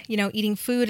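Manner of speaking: ordinary talk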